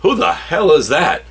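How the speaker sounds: high, loud astonished demand; hammed-up stunned question; Steve Trevor. Seeing Barda beat up the denizens, loud mock astonishment. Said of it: "Steve Trevor is like".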